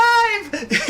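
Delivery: real helpless high-pitched laughter; shrieking laugh at the joke; high hysterical laugh. Real, not acted. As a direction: burst out cracking up. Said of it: "I was fucking in hysterics".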